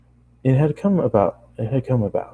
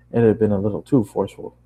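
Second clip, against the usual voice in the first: ordinary delivery, nothing marked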